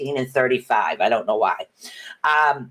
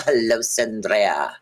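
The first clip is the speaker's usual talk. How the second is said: slow, drawn-out greeting in a light Spanish-tinged accent; 3 semitones lower, slower than usual. Real, not acted. Greeting a newbie from New Zealand, slow and in a Spanish-tinged accent. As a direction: greet her name with a slipped-in Spanish-style accent. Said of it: "I get this frickin' accent every time I say your name".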